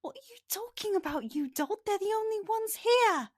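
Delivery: high, quick voice of a tiny scold; scolding tiny creature. Acted. Tiny winged figure scolds its host in his ear, high-pitched and scolding. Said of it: "a voice whispers in your ear and goes".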